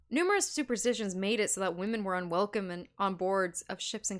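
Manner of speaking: ordinary delivery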